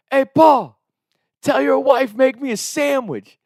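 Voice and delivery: high, loud, slow cheeky call; cross the line with a loud taunt; taunting yell. Retold or imitated. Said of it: "He's like".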